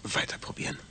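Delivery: whispered self-urging. Acted. Man whispering to himself to keep trying.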